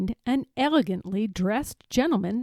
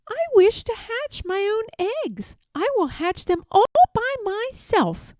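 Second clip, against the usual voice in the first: much higher, prim little duck voice; quacking duck; 11 semitones higher than usual. Acted. Jemima insisting to her sister-in-law, high duck voice. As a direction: chirp it up high like a stubborn duck. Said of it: "quacked Jemima Puddle Duck".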